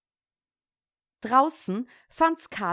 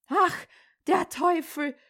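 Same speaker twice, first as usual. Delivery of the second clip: startled cry; 7 semitones higher than usual. Acted. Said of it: "rief der Pfarrer".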